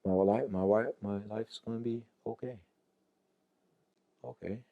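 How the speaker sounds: very soft, halting self-reassurance; cowed and quiet. Acted. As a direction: shrink down into a tiny, resigned voice. Cowed dog reassuring itself.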